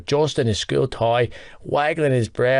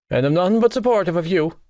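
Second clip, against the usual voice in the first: dramatic huff; 6 semitones higher, faster than usual. Acted. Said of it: "he huffed dramatically".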